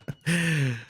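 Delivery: short high real laugh; a quick amused laugh at himself. Real, not acted. Caster laughs at own mistake.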